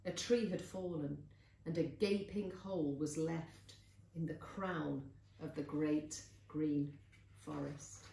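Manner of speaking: plain reading voice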